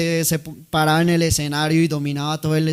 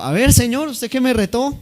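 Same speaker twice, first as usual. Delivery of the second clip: higher, louder showman's dare; cocky challenge; 7 semitones higher, 6 dB louder than usual. Acted. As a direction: throw down the challenge like a vain actor. Actor challenges old man. (reto desafiante y arrogante, más agudo y fuerte)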